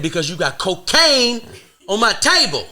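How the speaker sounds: high, loud laughing rant; mock rant. Scolding the woman over cocaine on his table, high and loud. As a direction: rant at her, voice climbing.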